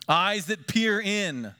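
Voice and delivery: normal talking voice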